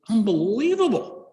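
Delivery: amazed. Acted. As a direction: marvel at a talking dog. Joke's man marvels at talking dog.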